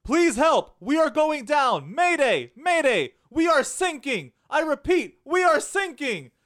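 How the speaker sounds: high, loud distress call; panicked mayday call. Retold or imitated. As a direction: yell for help like a sinking ship. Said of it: "an American S O S".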